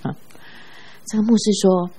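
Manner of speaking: normal talking voice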